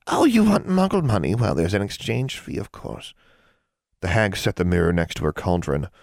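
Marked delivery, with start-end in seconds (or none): 0.1-3.1 lightly voiced scheming hag, a touch higher; an old witch toying with a girl; sly old hag. A narrator's reading. Hag bargaining with a young witch, lightly sly.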